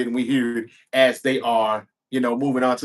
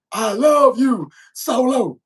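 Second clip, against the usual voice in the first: higher and louder, proudly declared; 8 semitones higher, 4 dB louder, slower than usual. Retold or imitated. Jacob Fatu's old catchphrase to Solo, loud and proud. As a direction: belt it out the way he used to. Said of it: "Loud and proud".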